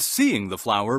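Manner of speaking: usual speaking voice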